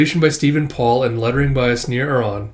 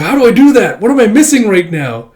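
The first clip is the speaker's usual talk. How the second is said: eager; 7 semitones higher, 8 dB louder than usual. Acted. Akane eagerly pressing the master.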